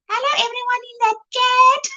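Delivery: high, put-on voice in a teasing mimic; playfully mimicking a chat friend; teasing imitation. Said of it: "She doesn't speak like that, but I like to tease her".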